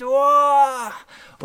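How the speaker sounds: long, high, loud "oh" scream; imitated scream. Old woman crying out at the boy's touch, imitated scream. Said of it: "Die schreit".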